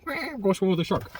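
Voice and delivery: high, excited, stressing I'm. Retold or imitated. Customer gushing about the shark trip, high and excited. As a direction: squeal it like a kid about the trip. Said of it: "I am going swimming".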